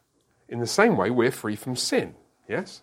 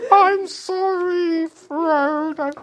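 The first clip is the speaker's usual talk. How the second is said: much higher, very slow, laughing mad hobbit; crazed Bilbo; 5 dB louder, much slower than usual. Retold or imitated. Teacher acting Bilbo going crazy over the ring, laughing and high. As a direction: stretch it out, half laughing, unhinged.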